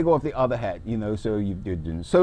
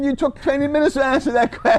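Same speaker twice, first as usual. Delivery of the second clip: teasing jab; 12 semitones higher, 3 dB louder than usual. Real, not acted. Host teasing guest's long answer.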